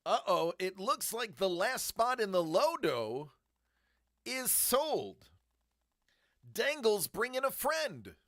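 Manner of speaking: loud, high announcer-like call; rising excitement, a fan's holler; excited Habs fan call. Real, not acted. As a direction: belt it out like a hockey fan. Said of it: "sounding like a Habs fan right now".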